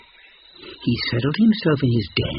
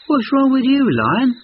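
much higher, louder monkey voice; concerned monkey; 13 semitones higher, 5 dB louder than usual. A narrator's reading. Monkey asking the lion what is wrong, high and eager. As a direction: call out like a worried little monkey. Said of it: "he asked".

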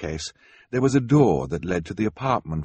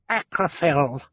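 put-on a lightly higher baby chirp; baby babble; 7 semitones higher, slower than usual. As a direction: chirp it like a small child. Baby Sunny babbles a word.

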